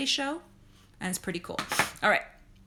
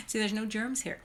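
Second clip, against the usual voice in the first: normal talking voice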